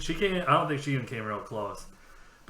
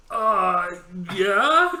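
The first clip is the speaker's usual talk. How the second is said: high, loud, questioning yes; mock hesitant reply; 7 semitones higher, 9 dB louder than usual. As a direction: imitate a very unsure agreement. Retold hesitant reply when asked.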